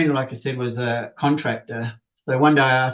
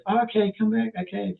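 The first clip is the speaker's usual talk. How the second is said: higher-pitched imitation of her; agreeable lady customer; 8 semitones higher than usual. Lady customer sending plumber off for the part, imitated agreeable voice. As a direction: lightly put on her higher, obliging voice. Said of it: "And she goes".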